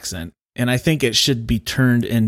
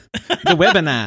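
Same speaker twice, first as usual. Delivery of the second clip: "The webinar" in a higher mock Australian voice; Australian accent; 8 semitones higher, 3 dB louder than usual. Retelling the coworker's line in Australian accent. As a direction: pitch it up, Australian vowels, drop off the end. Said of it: "with an Australian accent".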